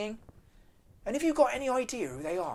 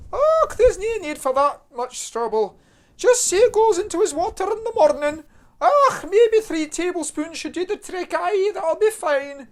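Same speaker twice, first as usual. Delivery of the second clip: high, slow broad Scottish mum; broad Scottish accent; 9 semitones higher, 7 dB louder than usual. Acted. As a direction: coax it out slowly in thick Scots.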